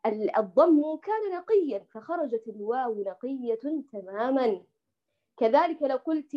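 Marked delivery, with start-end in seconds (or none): none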